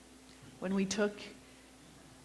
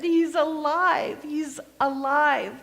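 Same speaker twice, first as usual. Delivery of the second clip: higher, louder, slow jubilant proclamation; joyful cry; 9 semitones higher, 10 dB louder, slower than usual. Acted. Mary telling the disciples, jubilant slow shout. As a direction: shout the news with wonder. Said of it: "And I said".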